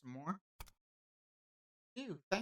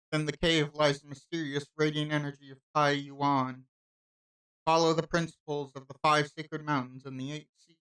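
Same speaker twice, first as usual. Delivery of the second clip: ordinary talk, nothing marked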